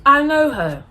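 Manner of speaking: surprised recognition. Retold or imitated. Boy recognizing the girl in the photo, stunned and loud. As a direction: gasp out the recognition, slow and loud.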